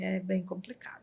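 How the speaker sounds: barely voiced, almost a whisper; hushed aside. Real, not acted. Woman telling a friend about cancer, whispered hush. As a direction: drop to a breathy hush as it sinks in. Grave aside after sad story.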